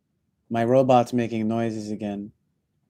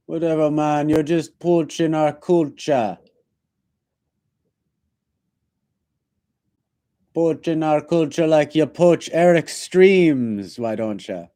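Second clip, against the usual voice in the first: light, louder mock Jamaican jab; Jamaican accent; 4 semitones higher, 4 dB louder than usual. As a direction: scold him for poaching culture, patois-style. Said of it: "talked like that".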